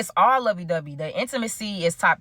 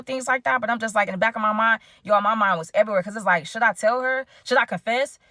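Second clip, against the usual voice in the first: ordinary delivery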